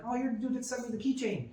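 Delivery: put-on light up recognizing the boy; delighted recognition. Dad thanking the keychain kid, high delighted voice. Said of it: "And I'm like".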